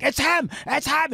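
high, loud, breakneck outburst of discovery; excited retelling. Retold or imitated. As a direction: imitate someone bursting with the news. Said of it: "'cause he's so excited".

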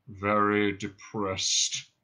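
very slow, low drone; depressed robot. Acted. Marvin answers Ford's greeting, depressed drone. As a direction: drag the words out in misery.

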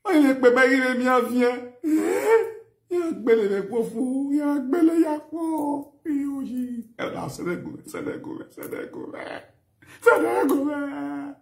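high, sobbing wail; crying lament. Real, not acted. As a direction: cry it out, voice breaking.

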